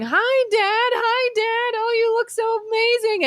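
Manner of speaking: put-on very high, sing-song boy's voice greeting his dead dad; retelling a viral clip, mimicking the boy in the hospital bed; imitating awestruck boy. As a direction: float up into a child's dreamy, awed voice. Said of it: "And then he starts saying".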